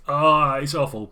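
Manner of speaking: woman blurting her verdict on the coffee; blurted outburst. Acted. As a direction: let it burst out too loud.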